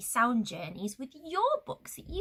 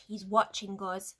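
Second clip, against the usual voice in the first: plain reading voice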